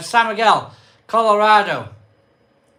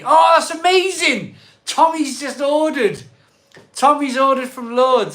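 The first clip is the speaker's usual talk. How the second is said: delighted; 7 semitones higher, 7 dB louder than usual. Real, not acted. Delighted at an order.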